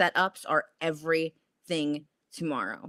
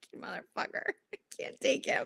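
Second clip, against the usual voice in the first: laughing curse; 13 semitones higher, 7 dB quieter than usual. Real, not acted. Laughing at friend's teasing.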